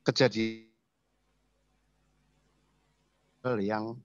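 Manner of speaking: broken-up connection dropouts; choppy breaking audio. Real, not acted. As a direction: voice cutting in and out on a bad line. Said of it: "Suaranya putus-putus, Pak". (suara putus-putus)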